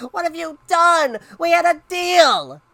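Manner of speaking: high, loud, outraged mom; furious parental yell; scream. Acted. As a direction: shout it drawn out in disbelief. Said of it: "she screamed".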